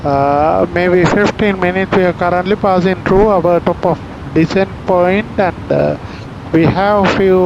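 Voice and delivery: calm airline PA voice; formal in-flight announcement; captain announcement. Real, not acted. Pilot's cabin PA announcement.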